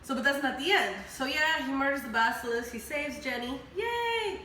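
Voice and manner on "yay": a much higher, bright-spirited yay; playful cheer. Real, not acted. Celebrating the rescue.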